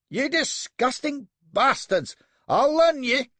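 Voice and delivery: high, loud, slow and raging; enraged young captain at the slavers; furious cracking yell. A narrator's reading. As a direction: scream it so the voice cracks. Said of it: "yells he, his voice fairly cracking".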